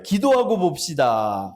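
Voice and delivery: slow, drawn-out preacher chant for the congregation to echo; slow call to repeat. Shown as a demonstration. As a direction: lead them, spacing out each word. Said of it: "저를 한번 따라할게요".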